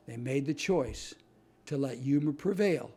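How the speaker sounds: usual speaking voice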